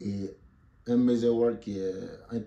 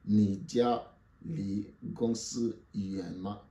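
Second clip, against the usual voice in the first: slow demo; much slower than usual. Shown as a demonstration. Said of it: "teacher repeats model sentence slowly".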